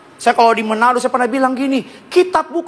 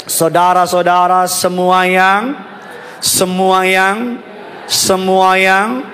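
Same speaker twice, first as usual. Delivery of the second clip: slow prompting; slower than usual. Acted. Drawn out, waiting for congregation.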